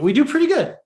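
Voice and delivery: rising into a laugh; breaking up at the joke. Real, not acted. Partner's joke cuts in.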